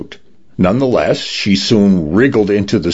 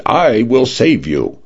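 slow, higher-pitched rescue announcement; mild shout; 5 semitones higher than usual. A narrator's reading. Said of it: "the lifeguard shouted".

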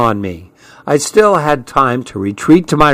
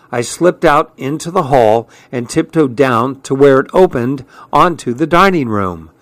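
usual speaking voice

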